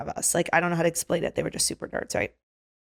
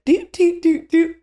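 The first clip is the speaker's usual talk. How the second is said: high, loud little "doot" beeps; playful pointing noises; 10 semitones higher, 6 dB louder, slower than usual. Retold or imitated. Picking out four cutters for the bouncer, playful doots. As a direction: mark each person with a high-pitched toot. Imitates pointing out each person.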